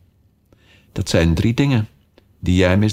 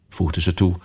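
ordinary delivery

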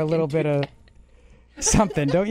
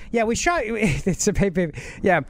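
usual speaking voice

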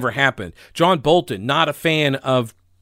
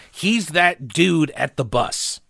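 normal talking voice

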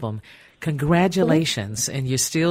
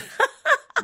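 real laugh. Real, not acted. Host laughs at album name.

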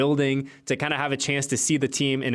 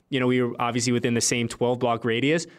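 ordinary talk

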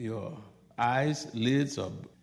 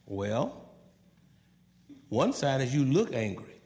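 ordinary talk, nothing marked

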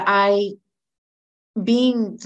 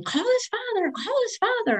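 much higher, mocking nagging repeat; imitating ex-husband. Woman mocking her ex-husband's nagging, much higher. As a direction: mimic the insistent ex. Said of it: "my ex-husband's telling me".

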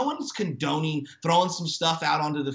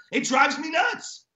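higher, slow, fed-up grumble; fed-up exasperation; 8 semitones higher, slower than usual. Real, not acted. Ranting about refs on air, exasperated and fed up. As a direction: vent it like a sports fan who is never happy. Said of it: "You sound like somebody from Boston that can't be happy".

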